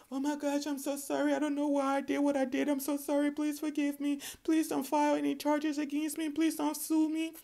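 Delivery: high-pitched pleading as a remorseful wrongdoer; mock the guilty party begging forgiveness; begging apology. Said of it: "Someone may come to you begging".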